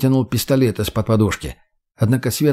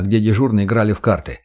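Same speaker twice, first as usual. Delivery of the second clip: ordinary delivery, nothing marked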